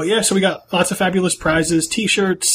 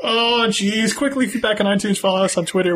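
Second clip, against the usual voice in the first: higher, fast panicky sign-off; panicked rush; 6 semitones higher than usual. Pretend. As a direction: hurry out before he arrives. Rushed outro fleeing Mark Rain.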